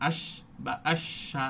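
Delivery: আশ with the শ hissed on and held long; আশা with a long flowing শ; held shin. Shown as a demonstration. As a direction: draw out the শ in আশ without stopping. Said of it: "জারি থাকছে".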